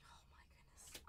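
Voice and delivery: breathless whisper of disbelief; awed whisper. Real, not acted. Opening a hot box for chat, stunned whisper. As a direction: hush it, stunned.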